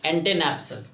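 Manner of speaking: "एंटेनेप्सल" read as ante-nap-sal; teacher modelling "एंटेनेप्सल"; pronunciation demo. Shown as a demonstration. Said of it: "क्या इसको पढ़ेंगे?".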